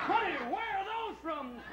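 high, slow astonished wife voice. Acted. Wife asking husband about her new breasts, high slow amazement. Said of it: "She wake up and like".

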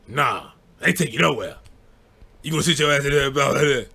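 a hint of a grumpy uncle refusing; lightly voiced uncle brushing him off; gruff uncle. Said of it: "my uncle was just like".

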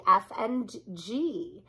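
usual speaking voice

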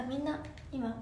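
ordinary talk, nothing marked

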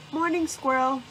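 chirpy greeting. A narrator's reading. Rabbit pops out of the bushes to greet Squirrel, chirpy. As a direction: call it out brightly as a lively rabbit.

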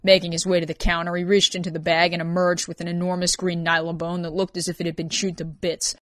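ordinary delivery, nothing marked

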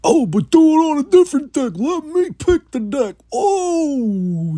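squeaky high mock heckler voice; mocking whiny voice. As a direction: whine like a heckler.

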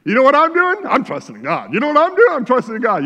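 mocking a believer broadcasting their trust; boastful show-off. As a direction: brag about your faith to everyone. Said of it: "They wanna tell everybody".

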